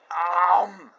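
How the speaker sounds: loud chomping gulp; gobbling noise. Acted. Wolf swallowing grandma, loud gulp.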